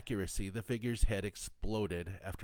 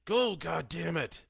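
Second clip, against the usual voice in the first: loud, raised, desperate command; father yelling at his daughters to flee; 9 semitones higher, 7 dB louder than usual. A narrator's reading. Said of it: "he yelled at them".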